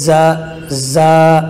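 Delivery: زا slowly drawn out with teeth together and a whistling hiss; زا stretched long on a sibilant whistle; whistled za. Shown as a demonstration. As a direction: draw out زا with a whistling hiss through closed teeth. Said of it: "دونوں دانتوں کو ملا کر، سیٹی کی آواز نکال کر".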